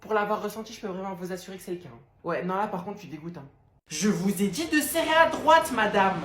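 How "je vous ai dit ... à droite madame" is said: loud, furious metro scolding; a fed-up Parisian shouting in the metro; angry Parisian yell. Acted. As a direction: bark at the blocking tourists. Parisian yelling at tourists in metro.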